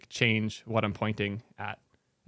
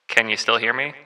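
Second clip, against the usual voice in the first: normal talking voice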